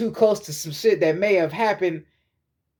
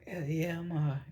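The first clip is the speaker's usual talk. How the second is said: imitating a voice cried hoarse, much softer; worn-out voice; 4 semitones lower, 11 dB quieter than usual. Sister answers friends, worn out from crying, softly. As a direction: barely get the words out, spent from crying. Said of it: "you damn near can't even talk no more".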